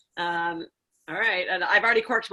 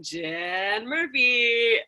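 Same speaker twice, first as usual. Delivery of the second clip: slow, drawn-out game show name imitation; imitating game show call; 3 semitones higher, much slower than usual. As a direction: stretch the name out like a host. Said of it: "I can always hear you going".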